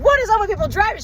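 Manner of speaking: high, fast incredulous outburst; retold exasperated complaint; exasperated question. Retelling own exasperated question.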